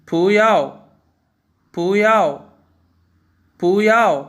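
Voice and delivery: पुयाओ as bú yào, rising bu then a loud falling yao; Mandarin bú yào. Shown as a demonstration. Teacher repeats the example for students to copy, loud clear tones. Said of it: "मसलन".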